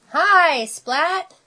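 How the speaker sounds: high, drawn-out chorus hello; class greeting. A narrator's reading. Classmates welcome Splat to class, sing-out greeting. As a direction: greet the new kid like a whole class.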